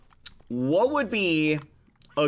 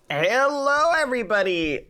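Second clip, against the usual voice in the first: excited greeting; 9 semitones higher, 3 dB louder than usual. Real, not acted. High excited stream greeting.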